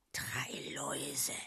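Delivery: low, slow, soft hiss; hissed under breath. A narrator's reading. Igor grumbles to himself on the walk, hissing. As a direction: hiss it to yourself in disgust. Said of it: "fauchte Igor leise vor sich hin".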